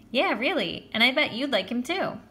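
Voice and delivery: high, bouncy teasing; lilting, loud enthusiasm; eager persuasion. Acted. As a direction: talk him up brightly. Joey eagerly talks up his friend.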